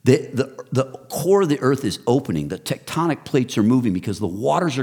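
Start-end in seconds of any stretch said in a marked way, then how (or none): none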